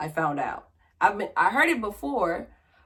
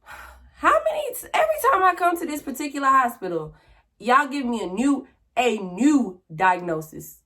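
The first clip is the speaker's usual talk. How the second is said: exasperated; 6 semitones higher than usual. Retold or imitated. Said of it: "And I'm just like".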